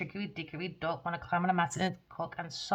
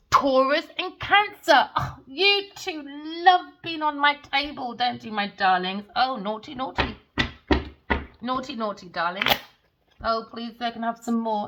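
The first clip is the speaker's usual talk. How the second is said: mocking posh hostess; 5 semitones higher, 7 dB louder than usual. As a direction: coo at them like a condescending grande dame. Mocking the signs at her table.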